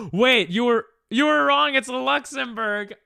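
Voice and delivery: gasping, high and loud; sudden shocked realization; surprised gasp. Real, not acted. Discovers wrong country.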